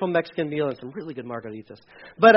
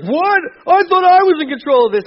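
high, indignant mock surprise; mock shock; 10 semitones higher, 8 dB louder than usual. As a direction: play the shocked one caught off guard. Mocking someone shocked at losing control.

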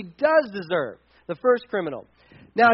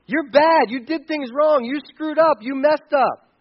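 higher, forceful mock telling-off; indignant scolding; 4 semitones higher, 3 dB louder than usual. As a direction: berate him as if outraged at his nerve. Acted scolding of the criminal.

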